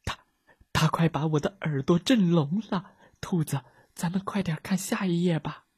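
scared, stammering whisper; trembling whisper. A narrator's reading. Frightened friend begs the rabbit to read on, trembling whisper. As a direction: whisper it shaking with fear. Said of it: "小胖打着哆嗦说".

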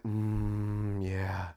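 low, hushed, drawn-out moans of agreement; mock-sensual radio host purr; Kristen. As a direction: murmur slowly as if getting off on it. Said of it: "and then Kristen's like".

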